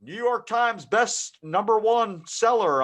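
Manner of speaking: mock the cover hype; hammed-up bestseller pitch; mocking blurb. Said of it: "it's like".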